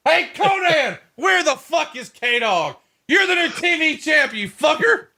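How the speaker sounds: screeching parody of a furious boss; mock furious boss. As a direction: ham up a furious boss firing off insults. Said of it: "that entire scene is hilarious to me".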